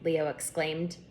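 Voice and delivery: ordinary delivery, nothing marked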